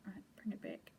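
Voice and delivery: very soft whispered aside. Real, not acted. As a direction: breathe it out to yourself. Quiet aside while handling fabric.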